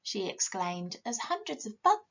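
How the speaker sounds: usual speaking voice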